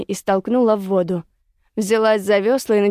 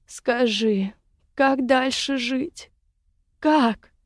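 slow, rising lament; wailing; 4 semitones higher, much slower than usual. A narrator's reading. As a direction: wail it out, drawing out the words. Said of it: "завыла в голос Женя".

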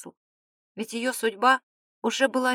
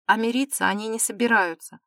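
plain reading voice

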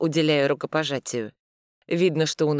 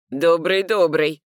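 loud nervous greeting; 6 dB louder, slower than usual. Acted.

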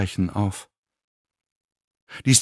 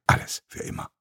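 whispered; 7 dB quieter, faster than usual. A narrator's reading. Fading into despair.

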